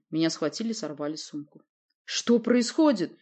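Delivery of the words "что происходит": higher, louder, slow astonished question; surprised question. A narrator's reading. Narrator asks as he is dragged away, surprised. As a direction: ask it slowly, taken aback. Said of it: "удивлённо спросил я".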